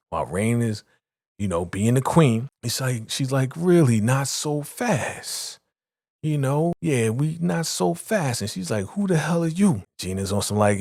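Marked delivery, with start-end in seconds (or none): 3.5-8.4 slow, high-pitched mock challenge; imitating a haughty interruption, slow and high; challenging. Retold rival challenging the new boss. 8.9-9.8 high-pitched retold retort; imitating an affronted comeback; indignant. Retold indignant answer to challenger.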